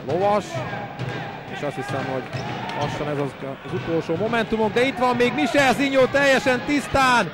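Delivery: huge shouted goal call; excited Hungarian sports commentary. Acted.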